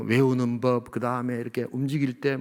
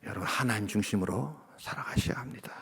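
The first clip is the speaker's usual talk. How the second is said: whispered exhortation; 5 semitones lower, 9 dB quieter than usual. Acted. Intimate exhortation to congregation.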